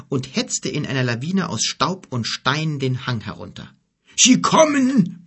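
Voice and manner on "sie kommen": viel höher und lauter gebrüllt. A narrator's reading. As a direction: panisch warnend herausbrüllen. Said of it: "brüllte er". (Kelim warnt die Gruppe, gebrüllt)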